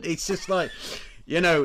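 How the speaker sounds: ordinary talk, nothing marked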